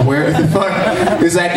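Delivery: ordinary talk, nothing marked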